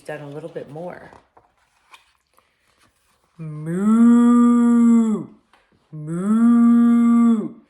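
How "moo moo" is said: long, loud mooing; cow moo. A narrator's reading. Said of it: "said the cow".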